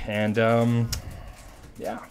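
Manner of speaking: ordinary talk, nothing marked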